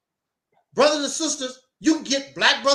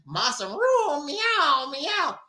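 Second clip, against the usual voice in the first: mock cat meow; 3 semitones higher, much slower than usual. Slave as cat begging master.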